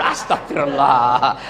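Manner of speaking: slow, low, half-laughing exasperation; mock exasperation. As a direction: sigh it out like a tired husband. (astagfirullah pelan dan berat, pura-pura lelah)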